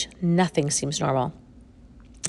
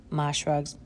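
ordinary delivery, nothing marked

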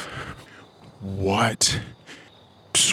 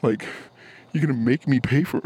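ordinary talk, nothing marked

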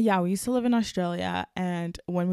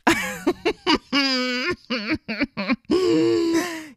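embarrassed laugh; 10 semitones higher, 4 dB louder than usual. Real, not acted. Laughing at her bad grammar.

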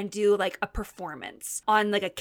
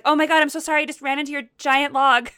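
flustered apology; 5 semitones higher, 6 dB louder, faster than usual. Retold or imitated. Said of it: "I was like".

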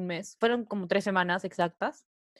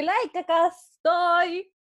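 high, drawn-out call to a dog; stretched-out, high-pitched greeting; retold excited call; 8 semitones higher, 4 dB louder, much slower than usual. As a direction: call your pet in a high singsong. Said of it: "de ahí le dije".

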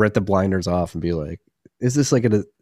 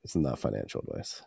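whispered aside; 6 semitones lower, 12 dB quieter than usual. Real, not acted. Quiet disclaimer aside.

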